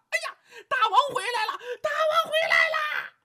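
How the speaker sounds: very high squealing monkey shout; excited monkeys. Acted. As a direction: shriek with joy like little monkeys. Monkeys shout seeing king.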